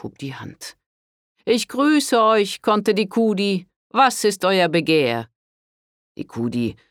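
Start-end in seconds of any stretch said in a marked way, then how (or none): 1.4-5.3 high, loud, stern rebuke of a priest. A narrator's reading. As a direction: scold her like a severe father confessor. Priest greets enemy lord. (strenger Pater, tadelnd)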